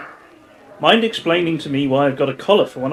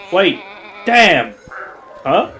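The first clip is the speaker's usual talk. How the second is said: loud sudden curse; caught out; 5 semitones higher, 4 dB louder, slower than usual. Acted. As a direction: blurt it out caught. Caught out, exclaims.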